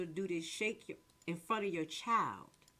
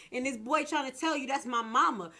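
louder, higher and worked up; real heated rant; 4 semitones higher, 6 dB louder than usual. Real, not acted. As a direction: vent it loud at the mothers. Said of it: "I'm sorry, I'm a little loud".

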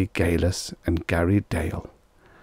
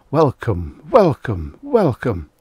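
mildly raised, rhythmic welcome; crowd greeting; 4 semitones higher, 4 dB louder, slower than usual. A narrator's reading. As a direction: hail them in short repeated calls. Banner words read as crowd greeting.

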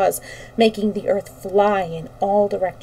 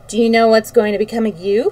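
ordinary delivery, nothing marked

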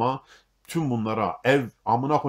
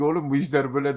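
uncle's voice; 4 semitones higher, 4 dB louder, faster than usual. Retold or imitated. Uncle voiced in retold story.

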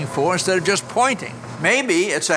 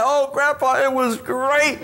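a hint of an excited girl, a little higher; lightly voiced granddaughter; 8 semitones higher, slower than usual. Granddaughter telling grandpa about her trip, lightly voiced and excited. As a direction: gush like a happy teen back from a trip.

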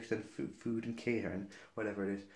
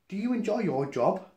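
higher, louder imitation of grandma; mock pointed question; 7 semitones higher, 5 dB louder than usual. Grandma confronts the rude server.

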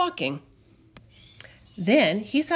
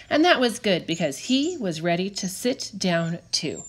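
plain reading voice

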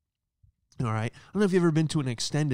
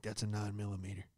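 much softer, low knowing line; gruff detective; 3 semitones lower, 10 dB quieter than usual. Pretend. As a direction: state it like a seasoned cop. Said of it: "I was like a detective".

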